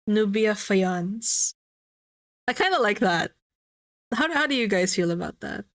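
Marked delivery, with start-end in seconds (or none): 0.1-1.5 slow and savored; savoring the name. Shown as a demonstration. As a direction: roll the name out slowly. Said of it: "trying the name out".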